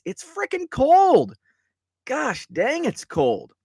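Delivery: high, loud and slow, stretching out the cold; exasperated outburst. Real, not acted. Host grumbling to viewers about the freezing weekend, exasperated loud outburst. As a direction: blurt it out like the cold is unbearable.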